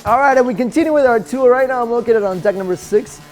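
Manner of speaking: loud, bright tour-host opener; upbeat cruise director. Acted. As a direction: kick off the tour with energy.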